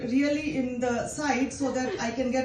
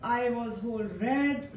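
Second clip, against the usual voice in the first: ordinary talk, nothing marked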